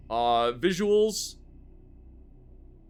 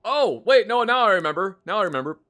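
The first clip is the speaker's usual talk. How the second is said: sudden recall; 5 semitones higher, 6 dB louder than usual. Real, not acted.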